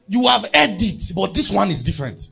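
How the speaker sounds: preaching at full force to the congregation; emphatic loud preaching. Real, not acted. Pastor pressing the church with a loud, slowed declaration.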